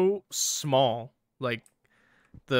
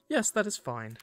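lightly voiced server, high and polite; takeaway worker; 8 semitones higher than usual. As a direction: reassure the customer in a raised voice.

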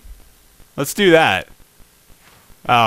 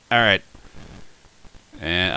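usual speaking voice

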